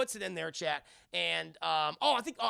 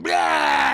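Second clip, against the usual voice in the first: low, loud monster bellow; demon roar; 13 semitones lower, 17 dB louder than usual. Retold or imitated. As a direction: bellow like a jump-scare demon. Goat demon jump scare.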